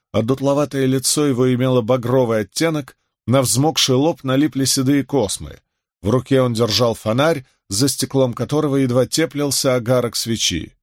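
plain reading voice

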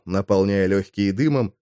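ordinary delivery, nothing marked

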